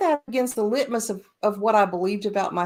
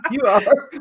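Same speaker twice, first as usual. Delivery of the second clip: louder, mock-insistent friend; emphatic friends; 6 dB louder than usual. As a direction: tell her bluntly as an amused friend. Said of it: "They're like".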